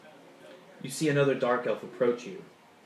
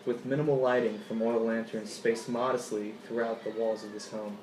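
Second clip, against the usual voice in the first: ordinary delivery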